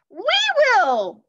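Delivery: very high, loud eager cry; shouted. Acted. Annie volunteering for the quest, shouting. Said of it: "shouted Annie".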